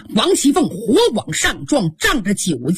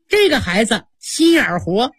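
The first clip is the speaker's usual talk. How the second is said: ordinary delivery, nothing marked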